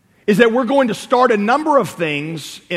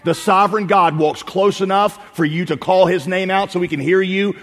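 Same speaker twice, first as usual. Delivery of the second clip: ordinary talk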